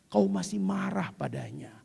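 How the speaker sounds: ordinary delivery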